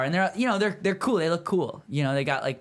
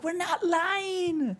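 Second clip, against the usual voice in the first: very high, slow, crying whine; mock-wail it like a protesting girl; whiny girl voice; much slower than usual. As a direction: teller mimics the girls protesting in a high, slow mock cry. Girls protesting, voiced by teller.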